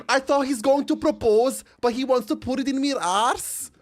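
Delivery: high, loud, hammed-up Northern English accent. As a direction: play up a broad North of England wail. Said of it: "that's my favorite English sentence".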